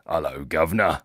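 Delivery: playful put-on English accent; mock English accent. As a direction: greet like a Cockney cabbie, just for fun. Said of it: "and I'm not English".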